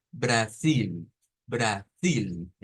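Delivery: Brasil in two slow parts, ending on a full English L; English-style L ending. Shown as a demonstration. Teacher models European Portuguese, English-like L. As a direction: hold a dark L at the end of Brasil. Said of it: "el mismo sonido como decir en inglés".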